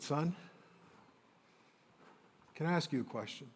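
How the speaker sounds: a hint of a soft, tender father, quietly asking; gentle father. Father quietly asking his hurting son a question, softly. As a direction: ask it gently, as a caring dad would.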